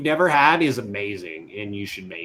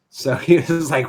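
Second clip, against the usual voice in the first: usual speaking voice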